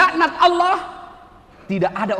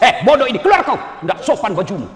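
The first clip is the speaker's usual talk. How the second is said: louder, rough mock rebuke; harsh scolding; 6 dB louder than usual. Imitating a rude lecturer throwing the student out, mock harsh. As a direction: bark at the student like a rude teacher. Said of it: "mana Ustadz ngomong kasar begitu".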